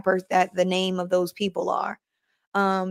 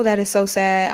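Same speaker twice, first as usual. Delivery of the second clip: ordinary talk, nothing marked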